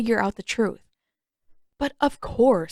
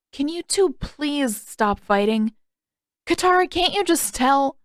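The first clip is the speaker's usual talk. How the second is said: put-on pleading kid; 6 semitones higher than usual. Aang pleading during the fight.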